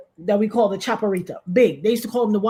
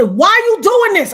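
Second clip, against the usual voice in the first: loud, much higher outraged cry; angry shout; 12 semitones higher, 9 dB louder than usual. Retold or imitated. Facing the gunman, a loud high furious shout. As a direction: yell it at the robber. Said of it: "I said".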